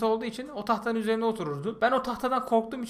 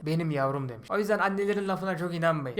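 ordinary delivery

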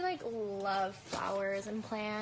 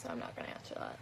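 whisper; 10 dB quieter, faster than usual. Real, not acted. Hushed dismissal of a question.

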